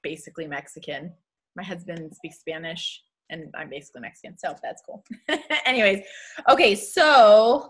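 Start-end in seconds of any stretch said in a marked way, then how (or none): 0.0-5.0 very soft, low aside; quiet aside. Real, not acted. Excusing the accent to viewers, very quiet low aside. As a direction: drop right down, almost under your breath.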